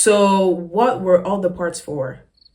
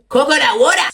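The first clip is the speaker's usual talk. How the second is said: New York accent; 6 semitones higher, 4 dB louder than usual. Shown as a demonstration. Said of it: "I like the way they say daughter".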